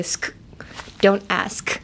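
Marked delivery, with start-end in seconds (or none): 0.0-0.3 tight clipped sk, voiceless. Shown as a demonstration. Teacher shows a tight sk. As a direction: squeeze the sk short. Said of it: "キュッとした感じで".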